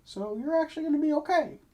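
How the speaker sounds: chirpy shopkeeper pitching wares. Acted. GM voicing shopkeeper.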